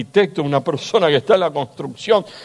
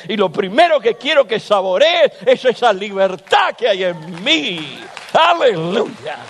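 full-force preaching; 6 semitones higher than usual. Acted. Pastor voicing God's promise to the church, fervent and raised. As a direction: lift the congregation with fervent preaching.

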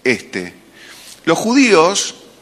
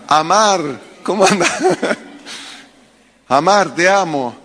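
laughing mock greeting; 4 semitones higher than usual. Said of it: "si yo le llamara Amar".

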